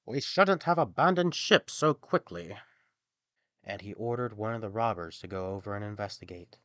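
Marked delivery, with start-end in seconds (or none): 0.1-2.6 captain. A narrator's reading. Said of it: "The captain of the band announced".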